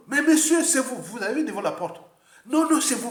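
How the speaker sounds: high, loud re-enacted protest; insistent arguing. Rejoue la dispute avec le livreur. (protestation rejouée, forte et aiguë)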